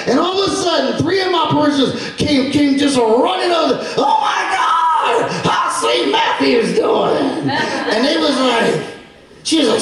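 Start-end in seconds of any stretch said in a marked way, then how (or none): 4.0-7.5 high, slow, stretched-out gushing; acted eager excitement. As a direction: imitate parishioners rushing up overjoyed. Parishioners run over, asking eagerly.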